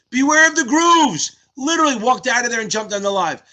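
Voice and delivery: high, loud, stretched-out hype; excited cheer. Real, not acted. Streamer celebrates a student who jumped on the live, excited cheer. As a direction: hail the winner at full voice.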